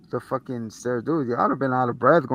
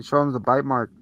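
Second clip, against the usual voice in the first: normal talking voice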